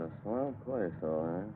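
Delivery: muttered under his breath; low mutter to himself. Acted. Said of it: "what are you muttering about".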